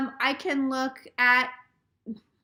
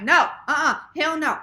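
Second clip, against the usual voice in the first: louder, firm no-no-no; emphatic denial; 4 semitones higher, 8 dB louder than usual. Retold or imitated. Denying readings to the banker, emphatic. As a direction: shut the idea down hard.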